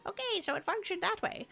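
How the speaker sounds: wavering, sing-song grandma voice; granny voice. Pretend. Streamer grumbles at her broken code as a granny, put-on old-lady voice. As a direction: talk like a put-on granny, pitch swooping up and down. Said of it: "I guess I'm talking like a granny".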